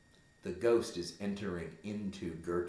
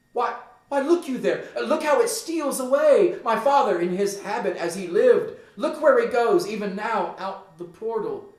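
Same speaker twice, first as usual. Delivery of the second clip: much higher and louder, urgent back and forth; frantic exchange; 13 semitones higher, 11 dB louder than usual. Acted. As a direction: urgently insist he sees it. Gertrude and Hamlet exchange about the ghost.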